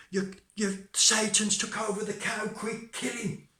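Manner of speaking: high, urgent, stammered start; alarmed parson. Acted. Parson orders his man to kill the cow, high alarm. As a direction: raise your voice in horrified urgency.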